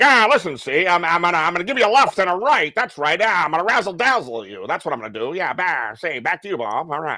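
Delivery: a hint of an old-time cartoon mobster, with "ah" and "see"; lightly voiced tough-guy wiseguy patter; gangster impression. Host hams up a mobster sign-off to his cohost in a light gangster voice. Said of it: "I'll be like".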